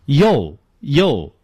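short "yo, yo" with an o vowel; mispronounced letter U as "yo"; wrong pronunciation. Shown as a demonstration. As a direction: say "yo" wrongly, rounding it into an o. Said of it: "错误的发成".

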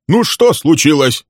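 slow, loud stern demand. A narrator's reading. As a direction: innkeeper confronting quarreling guests, stern. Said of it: "строго спросил Билл".

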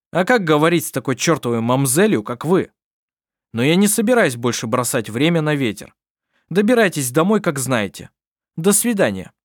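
loud, rude staged retort; gruff. Acted. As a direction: snap back rudely for show.

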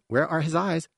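put-on high-pitched child's question; high young character voice; curious youngster. A narrator's reading. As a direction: ask in a small, high, wondering voice. Young one asks about newborn.

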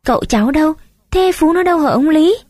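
rising, worried, asking fast one after another; anxious pressing questions. A narrator's reading. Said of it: "đã có ý lo hỏi dồn".

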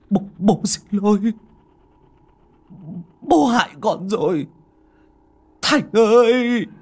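high, loud, choking cry of a drowning father; choked last words. Acted. Drowning father calls to his son, choked anguished cry. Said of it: "nói ra những lời nghẹn ngào cuối cùng".

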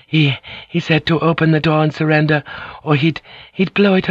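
high, halting, frightened woman's voice; frightened stammer. Acted. Mariko tells Blackthorn the threat, frightened stammer. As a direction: stumble over the words in fear.

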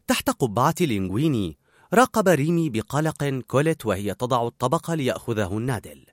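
ordinary delivery

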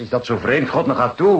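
loud, high, fed-up protest; exasperated outburst. Acted. Lover bristling at the detective's Brahms jab, loud and exasperated. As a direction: snap back at the insinuation.

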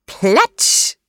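high, exclaimed splash; splash sound word. A narrator's reading. Narrator imitates a splash. (hoch ausgerufenes Klatschen ins Wasser)

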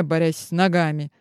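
usual speaking voice

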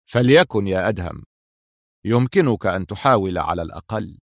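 understated cool dismissal; cold composure. A narrator's reading. Jailer regaining composure before his prisoner, mildly cold. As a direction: concede coolly, keeping anger in check. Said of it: "استعاد بروده بسرعة".

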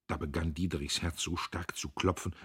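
usual speaking voice

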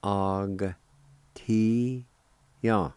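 separated syllables with long pauses. Shown as a demonstration. Teacher showing the second part of the word, syllable by syllable. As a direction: sound out each syllable on its own. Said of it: "you can pronounce the G ... like this".

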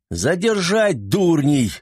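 slow drawn-out yelled command; shouted order. A narrator's reading. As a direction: bellow it late, stretching the words. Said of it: "запоздало заорал". (запоздалый крик)

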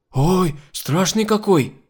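high, drawn-out frightened wail; hysterical fright. A narrator's reading. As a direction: wail as hysteria takes hold. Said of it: "зашлась Кока". (испуганно, протяжно причитая)

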